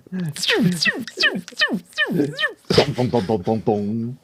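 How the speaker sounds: mock nineties broadcast sting; imitated TV sound effects. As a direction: mimic a news-show jingle with your mouth. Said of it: "sound effects from".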